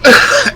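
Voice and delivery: short high-pitched laugh; a quick bright laugh. Real, not acted. Laughs at sauce joke.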